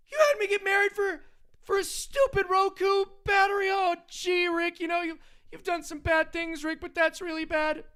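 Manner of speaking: put-on high, nervous whiny teen voice, way up in pitch; squeaky stammering teenage sidekick, pleading; Morty impression. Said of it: "doing a Rick and Morty impression".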